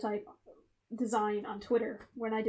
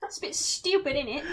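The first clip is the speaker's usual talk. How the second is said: mock British slang; 2 semitones higher than usual. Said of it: "cheeky Nando's".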